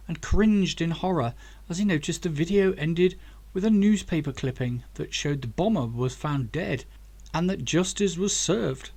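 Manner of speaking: usual speaking voice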